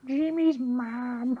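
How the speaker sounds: high, slow, crying cartoon kid; Carl. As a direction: wail it like whiny Carl. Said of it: "Is that Carl?".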